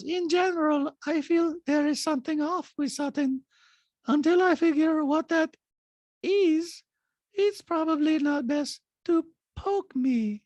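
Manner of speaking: high, chilling detached monotone; Diana. Retold or imitated. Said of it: "this kind of detached voice".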